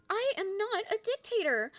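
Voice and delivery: high indignant shout of denial, in an imitated voice; a high-pitched cartoonish protest; Charlie protesting. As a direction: squeak it out, outraged and offended. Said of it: "she's like".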